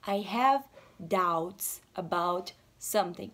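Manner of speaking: slow, lightly put-on American voice; model English sentence. Shown as a demonstration. Teacher showing students, slow careful English. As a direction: demonstrate the phrase slowly. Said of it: "você fala assim".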